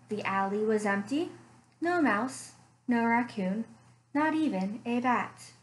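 story narration. A narrator's reading. Librarian reading a bear story to children, gentle storytelling. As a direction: draw the kids into a cozy mystery tale.

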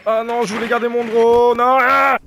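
high, loud wail of protest; dismayed cry. Real, not acted. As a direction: wail it out, ending in a yell. Cries out losing the drone. (cri de dépit)

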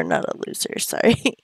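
soft whispered apology; whispered aside. Real, not acted. Muttering a whispered sorry to her Sim. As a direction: whisper the correction under breath.

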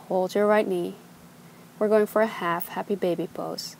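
ordinary talk, nothing marked

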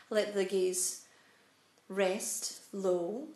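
calm, unhurried meditation guide, long pauses; measured instructions with room to breathe; guided meditation. Real, not acted. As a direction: settle them gently into stillness, phrase by phrase. Leads a seated meditation.

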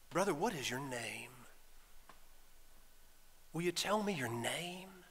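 low, soft, calm question; quiet and tender, voice dropped low. Acted. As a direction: ask gently, with no pressure at all. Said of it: "Jesus very calmly".

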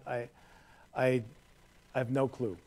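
ordinary talk, nothing marked

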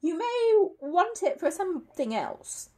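high, slow, tentative suggestion; anxious. A narrator's reading. Paddington trying to put Mr Curry off the rubble, anxious. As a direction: suggest it nervously, pitched high and drawn out. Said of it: "said Paddington anxiously".

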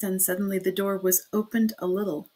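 usual speaking voice

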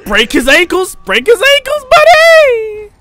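high, loud hype shout; excited yelling. Real, not acted. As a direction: yell it at the screen, fired up. Yelling at a game enemy.

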